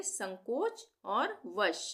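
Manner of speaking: संकोच and वश said slowly as separate words; split into parts. Shown as a demonstration. Teacher splits the word slowly for pupils. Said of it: "हम अलग अलग कर देंगे".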